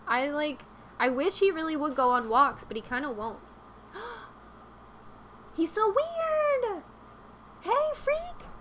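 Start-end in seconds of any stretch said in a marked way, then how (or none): 3.9-6.8 high, slow gasp of mock shock. Gasps at weird dog. 7.6-8.5 high teasing call at the dog. Acted. Calling out to the dog.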